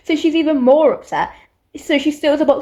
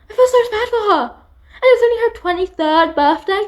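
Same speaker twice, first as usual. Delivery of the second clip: distressed; 8 semitones higher than usual. Real, not acted. Pity for the character.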